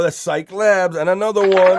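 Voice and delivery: loud, high showman call-out; hyped catchphrase. Acted. Host hyping his stream's catchphrase, loud and high. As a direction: belt out the catchphrase like a hype host.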